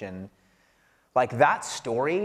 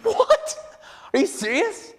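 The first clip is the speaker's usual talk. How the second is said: retold shocked disbelief, pitched far higher and louder; 1 stunned disbelief; 4 dB louder than usual. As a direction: jump way up in pitch, caught off guard. Retold shock at his score.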